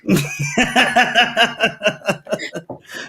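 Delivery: a big burst of real laughter; cracking up at his own story. Real, not acted.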